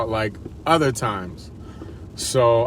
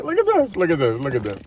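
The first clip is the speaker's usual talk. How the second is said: lightly voiced frustrated wife, high on look; mock complaint, mildly raised; imitating wife; 5 semitones higher than usual. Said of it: "She probably gets frustrated with me".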